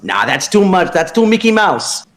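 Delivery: put-on high, loud, scolding old-timer voice; mock yell as the old trainer. Old coach berating a rookie, high mock yell. George berating Shepard, retold.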